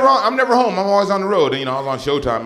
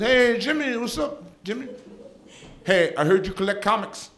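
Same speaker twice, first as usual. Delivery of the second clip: high-pitched imitation of mom on the phone; chummy mom; 8 semitones higher than usual. Mom chats up her son's friend by phone, high chummy voice. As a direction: greet an old family friend up high. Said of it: "my mom knows Jimmy".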